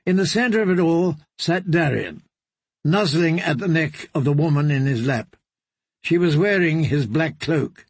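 ordinary delivery, nothing marked

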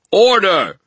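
very high, very loud ringing shout; booming command; demanding call. Acted. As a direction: silence the room with one word. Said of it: "demanded again in exactly the same tone".

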